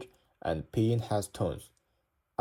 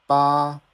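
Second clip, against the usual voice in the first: "ba" high, loud and level; first tone; 4 semitones higher, 8 dB louder than usual. Shown as a demonstration. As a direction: hold "ba" long on one flat high pitch. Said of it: "the tone is super flat".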